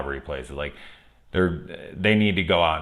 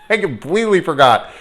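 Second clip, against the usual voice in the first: high, loud laughing admission; 11 semitones higher, 9 dB louder than usual. Real, not acted. Reviewer admitting a forgotten character, laughing. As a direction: blurt it through a laugh.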